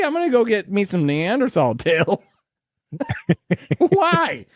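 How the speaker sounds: high laughing hillbilly shout; hillbilly accent, whooping with laughter. Pretend. Hillbilly caveman persona continues.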